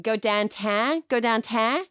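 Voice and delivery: "downtown" as "dahntahn", Pittsburgh style; Pittsburgh accent. Shown as a demonstration. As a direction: say "downtown" with flattened Pittsburgh vowels. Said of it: "My husband is from Pittsburgh".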